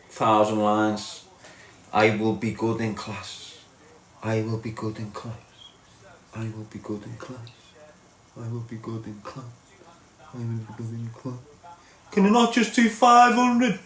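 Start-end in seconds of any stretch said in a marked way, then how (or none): 1.9-11.4 mock droning lines. Mocking writing school lines. 12.1-13.8 high, pleading schoolkid voice; a kid begging the teacher to cut the lines; mock whiny kid.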